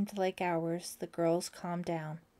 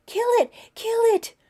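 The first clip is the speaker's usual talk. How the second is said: much higher and louder, screaming in terror; terrified cry; 13 dB louder than usual. A narrator's reading. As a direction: shriek it again and again. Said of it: "cried Tracy".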